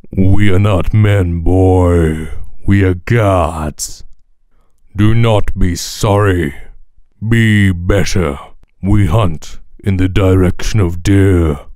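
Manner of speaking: put-on slow, low, loud god addressing a boy; game character voice. God lecturing a boy in lines from the game, slow booming voice. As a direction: boom it out slowly, stretching each word.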